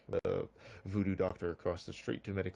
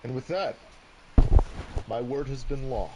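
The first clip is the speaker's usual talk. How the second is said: solemn proclamation; 5 semitones higher, 11 dB louder than usual. Acted. Judge's solemn closing pronouncement.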